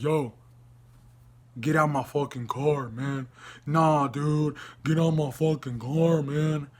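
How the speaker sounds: mock tough-guy dude voice; put-on street swagger, not shouted; gangster act. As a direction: imitate a wannabe gangster ordering someone out. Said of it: "he was acting very gangster".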